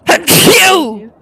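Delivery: loud real sneeze; big sneeze; sneezing. Real, not acted. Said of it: "I'm gonna sneeze, hold on".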